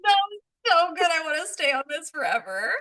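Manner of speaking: laughing hard, pained; wheezing laugh that hurts; laughing through pain. Real, not acted. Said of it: "Oh, it hurts to laugh".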